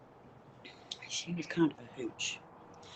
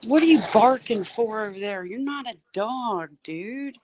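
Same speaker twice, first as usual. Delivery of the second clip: loud, high scolding call across the yard; raised-voice mock telling-off; 7 semitones higher, 12 dB louder than usual. Real, not acted. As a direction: holler it at someone barking over there. Calls out to barking pet.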